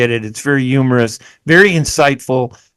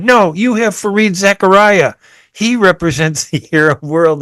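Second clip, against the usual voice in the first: higher-pitched, emphatic mock objection; retold objection; 6 semitones higher than usual. Audience member contradicting the speaker at a speech, retold objection. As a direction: quote a heckler firmly correcting you. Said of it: "somebody stood up in the audience and said".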